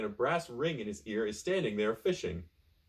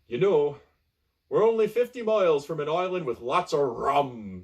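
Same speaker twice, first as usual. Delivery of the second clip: louder pirate boast; hearty pirate; 3 semitones higher, 6 dB louder than usual. Acted. Pirate tempts the castaway with rum, loud and hearty. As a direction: sell the rum island like a pirate. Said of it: "says the pirate".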